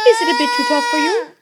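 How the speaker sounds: high, quick taunt; teasing wife. A narrator's reading. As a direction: needle the giant with a question. Wife taunts the hurt giant.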